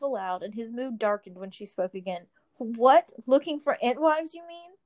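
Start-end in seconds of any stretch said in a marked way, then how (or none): 2.6-4.7 mock-teasing question, pitched up; a light teasing jab, rising high. Marabella teasing Faramir about Entwives, mock-incredulous. Girl mocks the boy.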